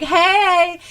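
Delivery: high, bright little hey; cheery hey. Retold or imitated. Southerner greeting a gas station clerk, high and cheery. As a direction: toss a sunny hello at a stranger. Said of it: "I'm like".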